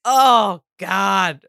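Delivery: high, loud disbelief; incredulous. Retold or imitated. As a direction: react in shocked disbelief. Mocking disbelief at his line.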